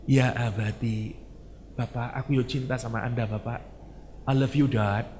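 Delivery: soft, loving reply to father; a son softly declaring his love; tender son. Acted. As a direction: answer your father gently and devotedly.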